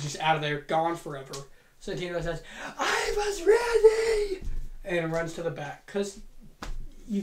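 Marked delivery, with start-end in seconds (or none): 2.8-4.4 slow, high, loud crying wail; a mock-tragic sob-shout of protest. Santino wailing after his instant elimination, crying shout.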